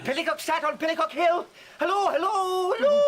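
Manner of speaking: high, fast crazed singsong rising to a squeal; mad raving. Acted. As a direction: babble like a lunatic. Poor Tom raving madly.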